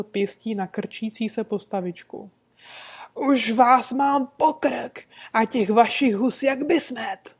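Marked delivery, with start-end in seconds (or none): 3.1-7.4 loud, angry yelling stallkeeper; bellowing. Acted. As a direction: roar it across the market at the little man. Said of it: "řval na celé kolo".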